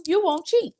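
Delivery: ordinary talk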